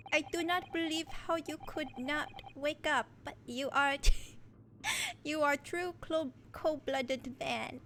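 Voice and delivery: halting attempt at a Russian accent; amateur Russian accent, breaking into laughs; bad Russian accent. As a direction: try a Russian accent and stumble through it. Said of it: "I'm really bad at Russian accents".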